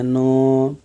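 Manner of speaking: नो held long as one sound; demonstrated nasal sound. Shown as a demonstration. Teacher showing students the nasal class, long-held नो. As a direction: draw out the nasal नो. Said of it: "इसको नासिक वर्ग बोला जाता है".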